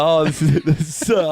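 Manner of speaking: words breaking up in laughter, loud; losing it laughing mid-sentence. Retold or imitated. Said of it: "I was losing it laughing".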